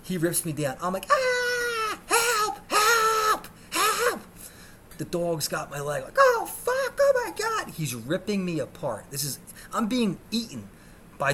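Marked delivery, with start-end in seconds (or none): 1.1-4.2 high, loud cries for help; screaming for help. Retold or imitated. Man mauled by a dog, screaming for help. 6.2-7.7 high, loud yelp of terror; panicked scream. Retold or imitated. Man whose leg is in a dog's jaws, panicked scream. Dog has his leg.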